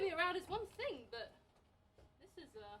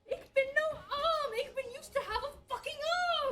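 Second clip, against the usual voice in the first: panicked shout; 11 semitones higher, 8 dB louder than usual. Acted. Kaiser discovering his missing arm, shouting in panic. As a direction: yell it in comic despair. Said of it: "What's all this shouting?".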